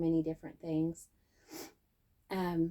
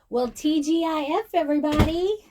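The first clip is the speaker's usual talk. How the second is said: high, loud sing-song TGIF; excited greeting; 8 semitones higher, 10 dB louder than usual. Real, not acted. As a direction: call out the Friday cheer.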